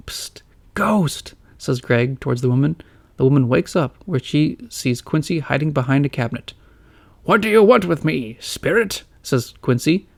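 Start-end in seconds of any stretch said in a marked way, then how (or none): 0.1-1.4 unvoiced stage whisper. A narrator's reading. Greg whispers to woman. 7.3-9.0 put-on slow, stately old gentleman; grand old uncle. A narrator's reading. Quincy voiced.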